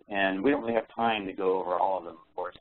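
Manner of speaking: ordinary delivery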